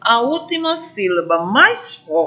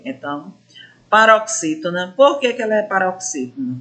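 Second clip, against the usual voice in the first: usual speaking voice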